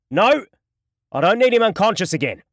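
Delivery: sharp loud high command; called out. A narrator's reading. Duran stops Holland's baton swing, loud sharp command. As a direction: bark the order to stop him. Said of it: "Duran called out".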